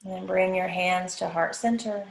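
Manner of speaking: even, unhurried body-scan voice, long pauses; guided meditation. Acted. Yoga teacher guiding the class through a body scan, guided meditation. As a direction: lead them through the body phrase by phrase, leaving space to breathe.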